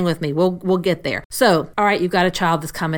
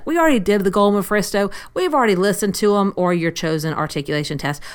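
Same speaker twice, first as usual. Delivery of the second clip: ordinary delivery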